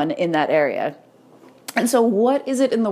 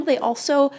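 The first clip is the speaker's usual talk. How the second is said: ordinary talk, nothing marked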